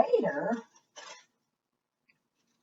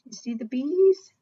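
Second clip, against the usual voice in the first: ordinary delivery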